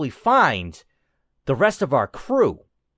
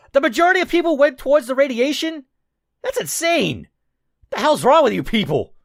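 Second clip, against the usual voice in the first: incredulous; 10 semitones higher, faster than usual. Real, not acted. Reacting to player choice stats.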